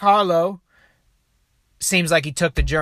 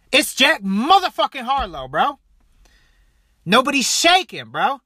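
hyped up; 7 semitones higher than usual. Real, not acted. Hyped praise of the star.

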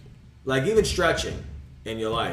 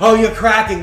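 mocking people; 9 semitones higher, 11 dB louder than usual. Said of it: "A lot of people".

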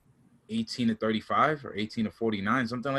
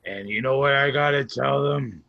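ordinary delivery

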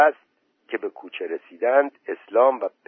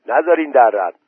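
louder shouted command; 4 semitones higher, 6 dB louder than usual. A narrator's reading. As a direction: yell it to rally the men. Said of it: "اسلام فریاد زد".

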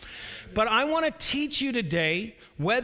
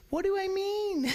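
high whiny question; congregation whine demo; 12 semitones higher than usual. Shown as a demonstration. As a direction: show them the whine to repeat. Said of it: "Everybody say".

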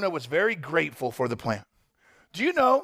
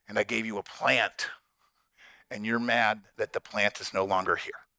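calm patient God; 6 semitones lower than usual. Retold or imitated. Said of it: "God sitting there going like".

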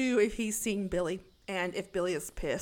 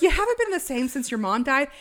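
louder, higher mock accusation; imitated callous complaint, raised voice; insensitive; 5 semitones higher, 7 dB louder than usual. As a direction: hammer it out as a tactless boyfriend. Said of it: "He's real insensitive right now".

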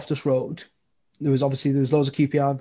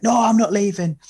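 player reassuring; 7 semitones higher than usual. Retold or imitated. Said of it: "he was like".

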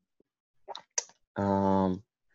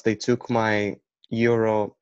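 ordinary delivery

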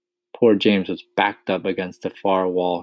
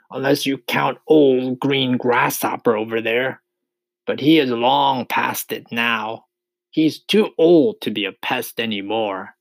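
slightly raised, teasing centipede voice; grinning centipede; 5 semitones higher, 5 dB louder than usual. A narrator's reading. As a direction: tease the old one with a grin. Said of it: "still grinning away".

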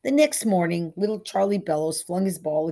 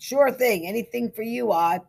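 loud, raised, hearty reply; jovial; 6 semitones higher, 6 dB louder than usual. A narrator's reading. Simpson answers the grateful groom, jovial. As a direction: agree with big good humour. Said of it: "Simpson laughed".

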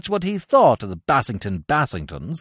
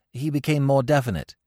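ordinary delivery, nothing marked

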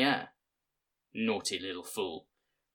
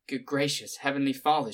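lamenting; 6 semitones higher than usual. A narrator's reading. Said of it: "she lamented".

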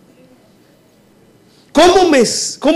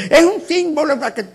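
mocking other pastors; 4 dB quieter than usual. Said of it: "a pastores diciendo".

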